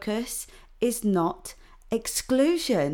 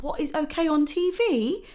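ordinary delivery

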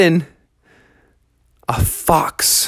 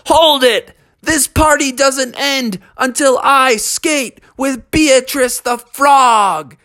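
commanding shout; 9 semitones higher, slower than usual. A narrator's reading. Froggy on the DJ's microphone, high bold shout. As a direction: seize the room, high and bold.

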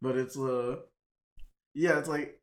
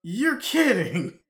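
loud, high, slow astonished exclamation; surprised disbelief; 8 semitones higher, 10 dB louder, much slower than usual. Real, not acted. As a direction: blurt it out in total disbelief. Stunned by Keanu news.

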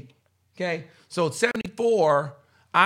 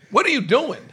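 higher, louder sharp demand; dad yelling; 6 semitones higher, 6 dB louder than usual. Retold or imitated. Father catches his son imitating a lisp, loud demand. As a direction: snap it like a startled father. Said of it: "And my dad said".

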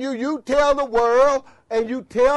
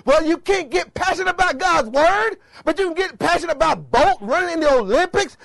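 high, loud, impassioned preaching; pitched-up, forceful rebuke; worked-up challenge; 6 semitones higher, 4 dB louder than usual. Real, not acted. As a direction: fire it at them as an indignant challenge. Challenges passion for sports over God's word.

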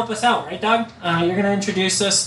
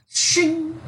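sword shing sound effect; 10 semitones higher than usual. Pretend. Showing off a sweet sword.

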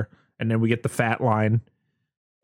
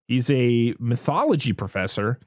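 ordinary delivery

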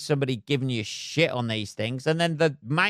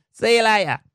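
mock farewell; 6 semitones higher, 5 dB louder than usual. Mock farewell to Chris Pratt.

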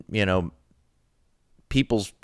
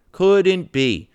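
higher, louder mocking repeat; sarcastic; 6 semitones higher, 4 dB louder than usual. Host mocking the official denial, sarcastic and higher. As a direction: scoff at the idea, higher and louder.

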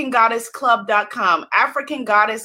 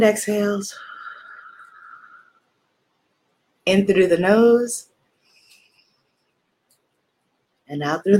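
calm breathing guide; 4 semitones lower than usual. Acted. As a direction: ease them into each breath. Breathing instructions.

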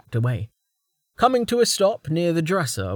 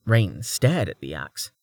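ordinary delivery

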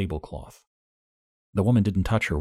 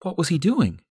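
much higher, startled rising questions; shocked June; 11 semitones higher, 4 dB louder than usual. A narrator's reading. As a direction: gasp it out as June. June voiced by narrator.

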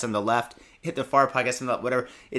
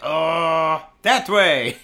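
put-on Japanese kid answering; 2 semitones higher, 7 dB louder, much slower than usual. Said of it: "And they'll be like".

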